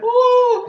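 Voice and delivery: loud falsetto "ooh"; imitated high whoop. Retelling the hair catching fire, imitated high whoop.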